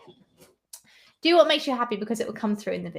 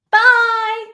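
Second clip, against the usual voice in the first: cheery bye; 12 semitones higher, 11 dB louder than usual. Real, not acted. Saying goodbye to a viewer.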